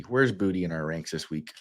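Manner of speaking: ordinary talk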